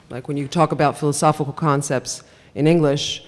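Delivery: usual speaking voice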